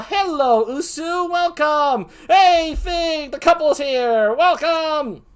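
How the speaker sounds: high, loud, drawn-out greeting; excited welcome. Real, not acted. Host greeting friends who just arrived, excited welcome. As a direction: call out joyfully to arriving guests.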